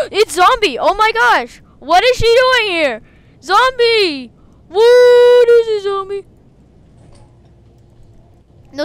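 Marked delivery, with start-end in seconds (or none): 0.1-6.3 high, loud, gasping surprise; astonished yelling. Real, not acted. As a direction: shout in disbelief at who appeared. Astonished at seeing Zombie.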